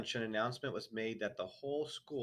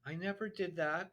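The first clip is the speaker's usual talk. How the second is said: higher, pleading denial; frightened boy; exclaimed denial; 9 semitones higher than usual. A narrator's reading. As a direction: blurt the denial out. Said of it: "the boy exclaimed".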